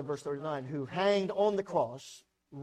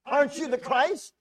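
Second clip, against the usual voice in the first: railing; 7 semitones higher, 6 dB louder than usual. Acted. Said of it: "He railed at him".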